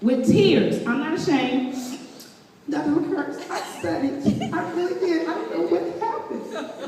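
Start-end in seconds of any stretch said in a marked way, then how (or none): 2.7-6.8 soft, half-whispered pleading with a laugh; tearful plea. Retold or imitated. Student pleading with professor after failing exam, softly. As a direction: beg quietly, mock-tearful.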